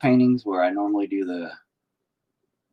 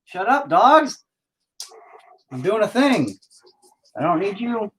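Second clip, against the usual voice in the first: calling to dogs; 9 semitones higher than usual. Real, not acted. Painter interrupting a stream to scold barking dogs, high-pitched. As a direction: shoo the dogs away in a high voice.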